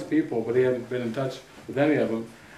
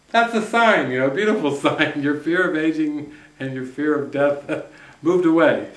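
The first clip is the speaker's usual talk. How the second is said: laughing delight; 2 semitones higher, 4 dB louder than usual. Retold or imitated.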